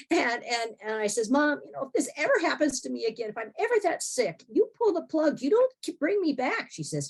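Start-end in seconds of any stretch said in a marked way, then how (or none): 1.3-6.7 higher, louder, insistent plea; emphatic demand. Retold or imitated. Daughter telling mom to pull the plug, emphatic demand. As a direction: lay down the law to mom, pitch climbing. Retold plea to her mother.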